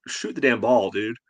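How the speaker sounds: usual speaking voice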